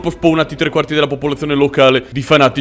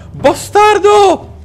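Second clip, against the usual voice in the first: furious drawn-out shout; 4 dB louder, much slower than usual. Real, not acted. Gamer cursing the archer who shot him, furious slow shout. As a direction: stretch the insult out, very high and slow.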